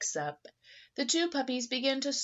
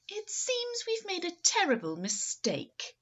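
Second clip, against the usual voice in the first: high excited cry; near shout; 11 semitones higher than usual. Acted. Missus Bulldog to Missus Poodle, a high excited cry. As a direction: blurt it out eagerly. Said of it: "she nearly shouted".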